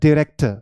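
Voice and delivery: director said "di-REK-tuh"; recommended pronunciation. Shown as a demonstration. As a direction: open director with a short "di", not "dye". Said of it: "as per my recommendation". (director को "डिरेक्टर" बोलें)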